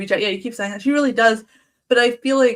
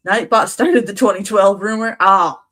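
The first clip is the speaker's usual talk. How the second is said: usual speaking voice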